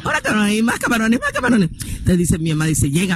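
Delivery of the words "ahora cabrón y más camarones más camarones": urgent ordering. Retold or imitated. Mother calls for more shrimp.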